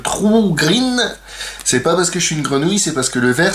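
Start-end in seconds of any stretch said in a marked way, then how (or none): 0.0-1.0 drawn-out, much higher English accent; exaggerated English accent. Shown as a demonstration. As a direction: stretch "True Green" in a hammed-up English accent. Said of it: "j'ai un super accent". (accent anglais très exagéré, traînant)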